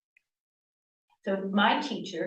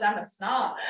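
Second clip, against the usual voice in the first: laughing at herself; 3 semitones higher than usual. Real, not acted. Self-mocking joke about East Coast.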